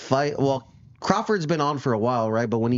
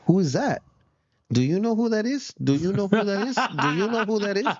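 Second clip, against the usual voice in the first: higher incredulous questions, cracking into laughter; mocking challenge; 8 semitones higher than usual. Host imitates the boxer mocking an opponent, laughing. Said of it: "and he was like".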